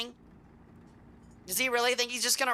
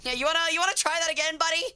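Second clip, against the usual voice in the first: mock tough-guy challenge; 6 semitones higher, faster than usual. Mock challenging the enemy player.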